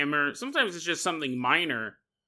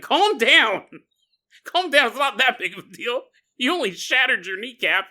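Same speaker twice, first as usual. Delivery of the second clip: mock shrink; 7 semitones higher, 5 dB louder than usual. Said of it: "a shrink to sit in the corner and be like".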